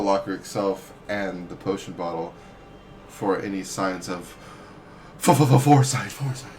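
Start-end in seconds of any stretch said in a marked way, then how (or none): 5.2-6.5 loud, higher stuttering burst; excited stammered call. Pretend. Player calling an ability on the bottle, excited stammer. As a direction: stutter into it, then blurt it loudly.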